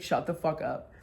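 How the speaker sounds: low, soft imitation of a friend's retort; understated, dry comeback; dismissive retort. Friend brushes off mock praise.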